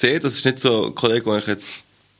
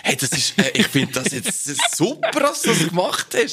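high, gushing fake admiration; mock ass-kissing praise; 6 semitones higher than usual. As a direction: lay the flattery on thick. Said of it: "So arschkrüchend nett".

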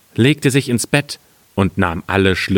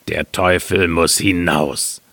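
deep, slow put-on miller; entschlossener Müller; 4 semitones lower than usual. A narrator's reading. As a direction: order it out slowly in a low voice. Miller orders devil out, low voice. (entschlossener Müller, tief und langsam)

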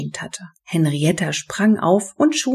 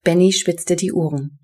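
ordinary delivery

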